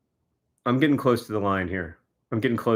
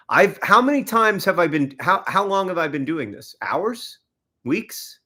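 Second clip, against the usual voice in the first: exasperated; 6 semitones higher, 3 dB louder than usual. Real, not acted. Exasperated at the long struggle.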